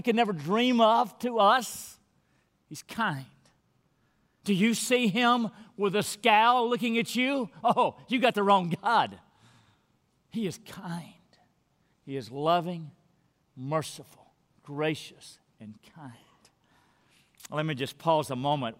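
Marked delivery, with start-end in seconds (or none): 2.7-3.2 soft, tender. Shown as a demonstration. 10.3-16.1 he is kind, breathed in a whisper; whispered tenderly. Shown as a demonstration. Preacher whispering God's kindness to his church.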